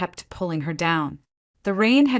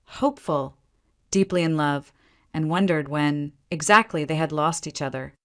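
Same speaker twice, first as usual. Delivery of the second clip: ordinary delivery, nothing marked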